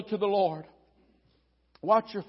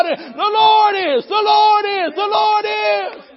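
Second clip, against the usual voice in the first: loud, rising preacher's cry, hammering "the Lord is"; full-force preaching; 6 semitones higher, 7 dB louder than usual. Acted. Preacher rallying the congregation that the Lord still reigns, shouting. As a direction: build it like a revival climax, voice cracking with fervor.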